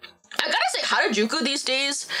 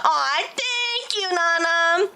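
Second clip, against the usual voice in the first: touched; 6 semitones higher, much slower than usual. Real, not acted. Touched by fan.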